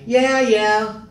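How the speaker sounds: high, dismissive character line; Ed brushing off. Acted. Actor rehearsing Ed's reply to the stew line, high dismissive voice. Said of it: "I'm ready to do my".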